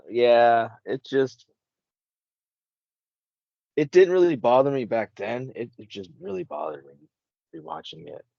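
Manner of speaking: normal talking voice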